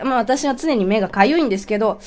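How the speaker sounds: ordinary talk, nothing marked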